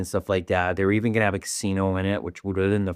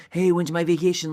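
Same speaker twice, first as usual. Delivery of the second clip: higher, lightly acted excitement; mock pumped; 8 semitones higher than usual. Host imitating a cruise customer awaiting vacation, mock pumped. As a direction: imitate an eager vacationer, pitched up. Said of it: "you're so pumped".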